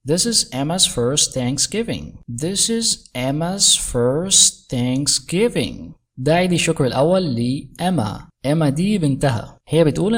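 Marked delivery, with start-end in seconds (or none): 2.4-5.9 very slow, word by word; slow, carefully separated words; slowed-down teaching repeat. Shown as a demonstration. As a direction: draw each word out for learners. Teacher repeats line slowly for learners.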